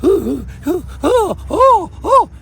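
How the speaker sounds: high, rising mock-frightened whimpers; mocking scaredy cat. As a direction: imitate a scared brother squealing. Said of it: "Jefferson was".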